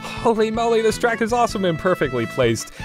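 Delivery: normal talking voice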